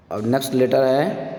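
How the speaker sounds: usual speaking voice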